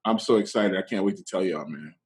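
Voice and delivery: ordinary delivery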